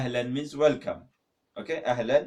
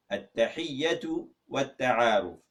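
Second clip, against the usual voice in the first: ordinary delivery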